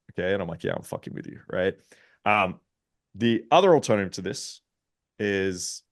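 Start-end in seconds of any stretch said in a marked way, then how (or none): 0.6-1.4 hushed, breathy teasing confession; whispered I'm-kidding reveal; teasing aside. Retold or imitated. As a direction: let her in on the joke under your breath. Teasing admission after fake nervousness.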